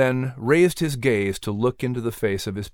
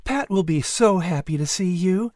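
higher, lighter mother's voice; pleased mother, pitched up; Sarah; 8 semitones higher than usual. A narrator's reading. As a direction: voice the kindly mother. Sarah's voice in the story.

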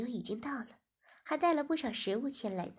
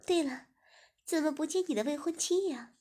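louder, higher nosy guest; curious young woman; 5 semitones higher, 5 dB louder than usual. Acted. Guest Ziying asking where the fiancée is, higher. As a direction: pipe up curiously.

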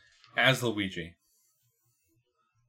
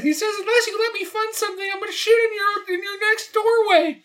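high squeaky Toad voice; 4 dB louder than usual. Acted. Toad voice, laughing.

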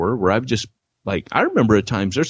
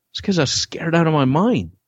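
rising higher with each line; fearful confession, higher as it goes; confessing fear; 11 semitones higher than usual. Retold or imitated. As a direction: pour out the fear, climbing up. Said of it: "I said".

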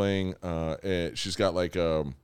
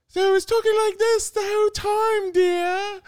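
put-on very high, louder old-lady falsetto; Mrs. Doubtfire voice; 5 dB louder than usual. Dad reliving the lady of the house game, high falsetto. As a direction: trill it like a prim nanny. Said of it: "a Missus Doubtfire accent".

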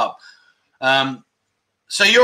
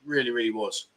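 ordinary delivery

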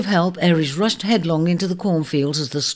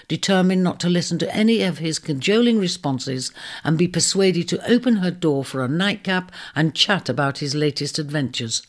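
ordinary delivery

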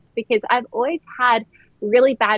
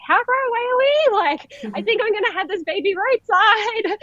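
high, fast panicked cry; shrill rush of alarm, nearly crying; retold panic; 11 semitones higher than usual. Asking her husband in the car, high panicky near-crying voice.

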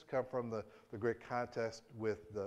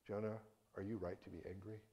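soft gentle question; 3 semitones lower, 10 dB quieter than usual. Acted. God asks again, same question.

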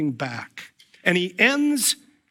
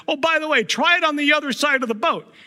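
breezy, much higher, quicker offhand suggestion; mock casual advice; 11 semitones higher, 3 dB louder, faster than usual. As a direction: toss it off like an irritating know-it-all. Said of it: "somebody said".

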